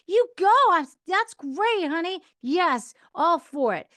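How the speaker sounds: high, loud, eager yes-man voice, then drawn out on that's great; husband's cheery approval. Retold or imitated. Wife imitating her husband's eager support for her plans. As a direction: gush your support like an overly agreeable husband. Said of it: "the only response I ever got was like".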